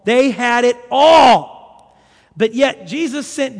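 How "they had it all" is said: loud preacher's climax, last word stretched out; slow down and boom the final word; emphatic proclamation. Real, not acted. Preacher summing up a rich city's glory, emphatic.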